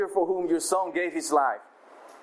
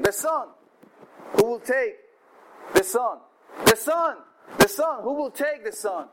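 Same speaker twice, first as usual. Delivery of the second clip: auctioneer calling; 5 semitones higher than usual. Acted.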